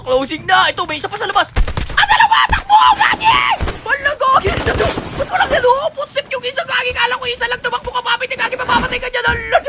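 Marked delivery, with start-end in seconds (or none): 2.0-3.3 high-pitched panicky shout; panicked gamer yelp; panicked yell. Real, not acted. Panicking, two chasing him. 3.8-9.6 very high, fretful squeal; high-pitched gamer panic; panicked babble. Real, not acted. Alarm at being outnumbered.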